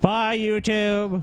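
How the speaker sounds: stretched, slow bye; slow goodbye. Pretend. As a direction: drag out the farewell. Drawn-out goodbye.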